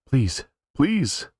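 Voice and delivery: put-on high, desperate child's begging; a small boy pleading through tears; high-pitched sobbing please; crying plea.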